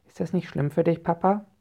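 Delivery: put-on high-pitched, concerned child; little child's worried question; Fuchsia. A narrator's reading. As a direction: ask Papa in a child's high voice. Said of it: "fragt Fuchsia".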